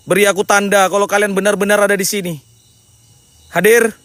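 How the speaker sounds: calling spirits. Acted. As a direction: call out into the dark forest.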